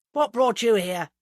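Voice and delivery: loud, blunt demand. A narrator's reading. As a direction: challenge unwelcome visitors bluntly. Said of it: "asked Mister Parr bluntly".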